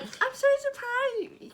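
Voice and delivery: high, very slow drawn-out mock voice; midwife's surprise, mimicked slow and high. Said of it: "And she's like".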